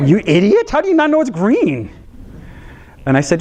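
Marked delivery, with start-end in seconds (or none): 0.1-1.9 put-on high, incredulous child's scoff; mock exasperation. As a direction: scoff like a kid stunned at dad's dumb question.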